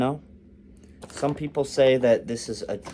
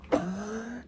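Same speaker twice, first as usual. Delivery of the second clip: giggle; 11 semitones higher, 6 dB quieter than usual. Real, not acted. Still laughing at joke.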